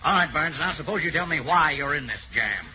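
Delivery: ordinary talk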